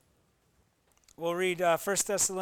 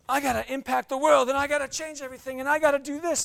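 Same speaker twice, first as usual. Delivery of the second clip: higher, louder, rushed mock urgency; mock overzealous; 6 semitones higher, 7 dB louder, faster than usual. Pastor parodying an overzealous believer, higher and louder. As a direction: parody someone frantic to change the world. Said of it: "Don't be so overzealous".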